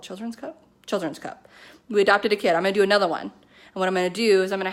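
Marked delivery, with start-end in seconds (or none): 0.0-0.6 quietly unsure of the name; unsure question. Real, not acted. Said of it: "I don't know what that company's called".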